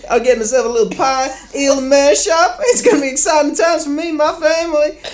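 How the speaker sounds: comic David Beckham phone call voice; David Beckham impression. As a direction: do a breathy-free high London footballer voice. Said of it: "It's a fantastic accent".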